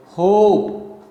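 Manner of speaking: "hope" said firmly as a strong beat; stressed syllable. Shown as a demonstration. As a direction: give "hope" full stress, then let it fall. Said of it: "hope is stressed here".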